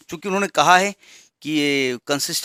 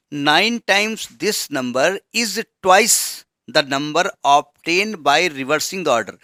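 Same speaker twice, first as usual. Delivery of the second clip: very slow, word-by-word reading of the problem; dictating the question; much slower than usual. Shown as a demonstration. Teacher dictating the word problem to the class, very slow. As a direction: read it out slowly so students can copy.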